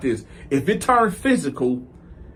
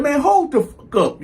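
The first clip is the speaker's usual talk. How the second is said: raised, high-pitched retort; irritated outburst; 8 semitones higher than usual. Retold or imitated. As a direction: snap back as if fed up. Retold outburst at the ushers.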